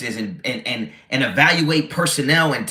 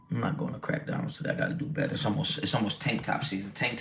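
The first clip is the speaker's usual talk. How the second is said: quiet mutter; 7 semitones lower, 12 dB quieter, faster than usual. Real, not acted. Muttering a diet resolution.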